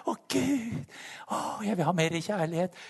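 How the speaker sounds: Å, Gud pitched high and pleading, then eases off; whiny mock prayer. Preacher mocking his old selfish prayer, whiny pleading. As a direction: mimic a needy begging prayer. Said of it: "Det jeg egentlig sa".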